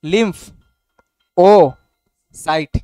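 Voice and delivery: "লিম্ফ ... ও ... সাইট" in three separate pieces, long pauses between; broken into syllables. Shown as a demonstration. Teacher helping students pronounce it, "লিম্ফ ... ও ... সাইট" broken syllable by syllable. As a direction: say "লিম্ফ, ও, সাইট" one syllable group at a time. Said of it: "syllable ভেঙ্গা ভেঙ্গা পড়ো".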